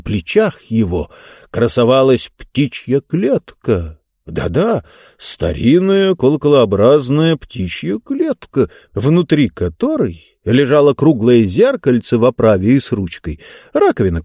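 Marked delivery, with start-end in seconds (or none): none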